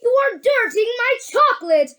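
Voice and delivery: high, agitated protest; crying out. A narrator's reading. Wonka upset about his chocolate, crying out high. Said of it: "cried Mister Wonka".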